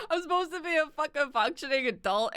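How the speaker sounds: high, loud, self-mocking laughter in the words; laughing. Real, not acted. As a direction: crack up while lamenting yourself. Laughing at own messy house.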